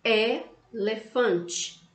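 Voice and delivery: elefante stretched out slowly; teacher modelling for children; slow sounded-out word. Shown as a demonstration. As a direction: drag elefante syllable by syllable. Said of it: "é".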